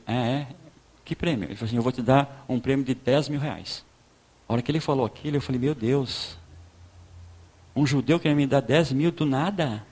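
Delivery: ordinary delivery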